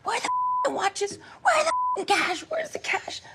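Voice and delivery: high, harsh mock robber shout; demanding shout. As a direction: bark demands like a thief. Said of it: "one of them that was collecting".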